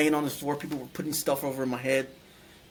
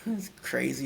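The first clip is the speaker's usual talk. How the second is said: ordinary talk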